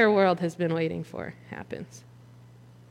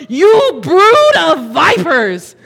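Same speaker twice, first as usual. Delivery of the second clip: higher, louder, slow, each word hammered; thundering rebuke; 7 semitones higher, 12 dB louder, much slower than usual. Acted. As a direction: spit it at the religious leaders. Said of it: "and goes".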